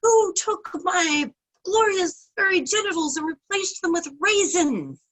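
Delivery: high, loud furious roar from the tiger; angry roar. A narrator's reading. Tiger storms into the village, furious roar. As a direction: bellow it out, outraged. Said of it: "very angrily storms into the village and says".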